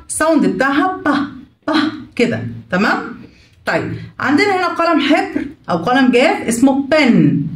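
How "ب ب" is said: isolated voiced ب, short and heavy; letter sound B. Shown as a demonstration. Teacher to kids, sounding out the letter B. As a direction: give the bare sound ب.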